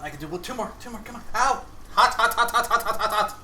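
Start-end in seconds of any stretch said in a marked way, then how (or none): 1.4-3.4 high, loud, laughing yelps of pain; pained yelping. Real, not acted. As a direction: yelp hot again and again while laughing. Just burned hand on oil.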